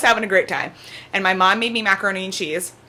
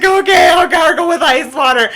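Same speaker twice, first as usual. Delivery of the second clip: higher, louder mock-compliant yell; retold loud agreement; mock shout; 8 semitones higher, 8 dB louder than usual. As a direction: bark it back, hammed up. Repeating doctor's order.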